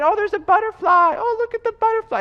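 put-on high, delighted little-kid voice; carefree child. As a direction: marvel innocently, oblivious to danger. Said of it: "they're thinking everything is hunky-dory".

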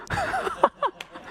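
high laugh. Real, not acted. Preacher laughs at punchline.

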